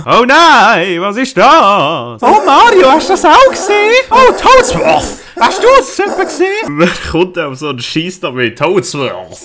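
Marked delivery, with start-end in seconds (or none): 0.1-2.2 mock alarm. 2.2-6.5 put-on very high, loud, whiny old servant; squeaky old Toad voice. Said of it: "komische Stöhngeräusche".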